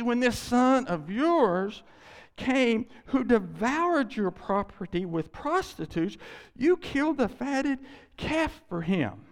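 slow, loud, high resentful charge; older son accuses father of favoring the prodigal, slow and bitter; bitter accusation. Acted. Slow bitter indictment.

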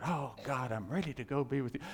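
low, soft dying surrender; deathbed prayer. Acted.

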